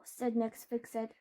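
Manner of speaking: ordinary delivery, nothing marked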